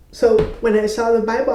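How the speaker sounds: normal talking voice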